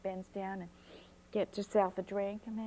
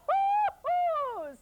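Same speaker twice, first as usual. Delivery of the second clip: grandma hooting laugh; 7 dB louder than usual. Acted.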